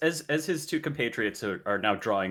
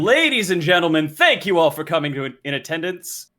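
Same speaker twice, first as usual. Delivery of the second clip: loud, higher herald proclamation; royal herald; 6 semitones higher, 7 dB louder than usual. Acted. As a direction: announce it to the crowd, rising. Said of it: "in his best herald voice".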